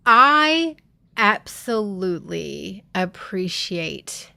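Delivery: ordinary delivery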